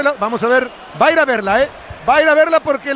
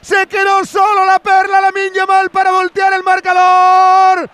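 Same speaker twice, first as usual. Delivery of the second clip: excited commentary; 5 semitones higher than usual. Real, not acted. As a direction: shout the play as it happens. Commentator calls the goal live.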